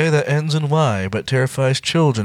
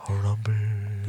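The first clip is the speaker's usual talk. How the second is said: whispering; 4 semitones lower, 8 dB quieter than usual. Acted. Hushed tribute.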